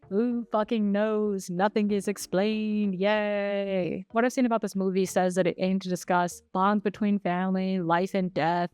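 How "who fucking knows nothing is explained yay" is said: slow, drawn-out fed-up sarcasm; sarcastic exasperation. Real, not acted. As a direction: drag it out with mock enthusiasm at the end. Fed up with unexplained ending.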